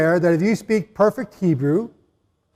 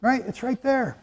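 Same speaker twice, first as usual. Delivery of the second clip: ordinary delivery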